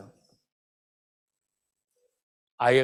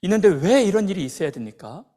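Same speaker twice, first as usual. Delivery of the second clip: raised, pleading demand to God; protesting prayer; 4 semitones higher, 6 dB louder than usual. Acted. Believer in pain asking God why, anguished protest. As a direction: plead upward, growing louder with each why. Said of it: "기도하는 것입니다".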